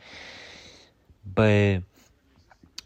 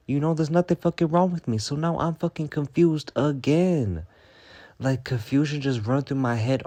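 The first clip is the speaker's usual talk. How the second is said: higher and louder, exasperated; frustrated outburst; 6 semitones higher, 4 dB louder than usual. Real, not acted. Fed up with people calling her crazy, frustrated outburst. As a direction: vent it, fed up with being doubted. Ranting against being called crazy.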